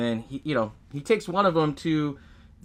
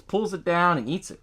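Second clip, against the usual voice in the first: ordinary talk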